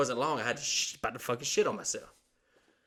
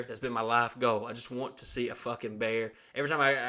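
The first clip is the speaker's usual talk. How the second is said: normal talking voice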